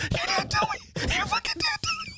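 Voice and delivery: very high laughing mom voice; squealing mom. Retold or imitated. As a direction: shriek it through laughter.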